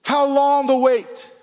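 loud, high, drawn-out call; preaching cry. Real, not acted. Pastor challenging the congregation, loud and slow. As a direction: belt the question slowly to the room.

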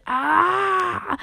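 high sharp cry; frustrated yelp. Real, not acted. Stumbling over the word, high frustrated yelp. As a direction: cry out at the tongue slip. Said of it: "I keep saying developer".

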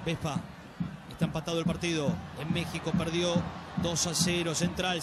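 normal talking voice

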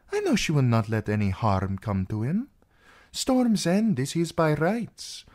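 animated princess voice, rising and falling; persuasive princess. Acted. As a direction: press the claim with conviction. Princess argues for the girl's claim.

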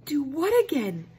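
high, loud sharp demand. Acted. Girl demanding to know what her parents mean. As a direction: demand an answer right now. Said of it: "she demanded".

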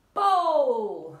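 loud, long-held call; bowling call. Shown as a demonstration. Coach calling the bowling move, loud and drawn out. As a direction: throw the word out loudly, stretched.

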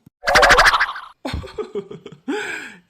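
a loud, high, mocking ghostly cackle; evil ghost laugh. Ghost mocking the old woman's threat with a loud cackle. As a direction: cackle like a haunting spirit.

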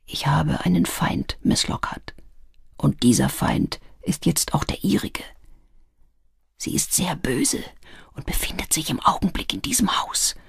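quiet, fearful half-whisper; low, quiet voice. A narrator's reading. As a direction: confide the danger in a frightened hush. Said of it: "mit leiser Stimme".

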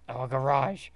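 usual speaking voice

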